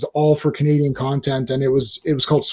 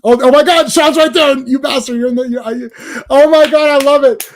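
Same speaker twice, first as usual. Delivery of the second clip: higher and louder, delighted and teasing; excited outburst; 9 semitones higher, 6 dB louder than usual. Real, not acted. Streamer spots his friend in chat, excited outburst. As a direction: blurt it out as a friend appears.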